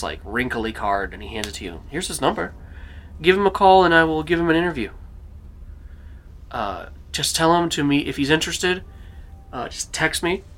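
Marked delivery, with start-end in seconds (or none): 1.9-10.4 lightly voiced character, pitched higher; slightly put-on higher voice, handing on instructions; Richard's voice. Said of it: "and Richard goes".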